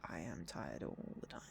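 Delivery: soft whispered complaint, sinking low; tired whisper. Real, not acted. Whispering to viewers about being always tired. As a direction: breathe it out, too tired to voice it.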